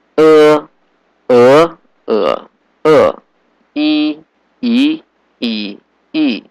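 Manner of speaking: E high level, rising, dipping, then falling; four-tone drill. Shown as a demonstration. Teacher reading the tone table, four tones on E. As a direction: sustain each E on its own Mandarin tone. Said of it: "tone tables for these six vowels".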